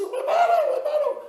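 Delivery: shrieking high and loud, half-laughing in panic; frantic pleading. Acted. Mother to thief grabbing her son's medicine, frantic high shriek. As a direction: beg him desperately not to take it.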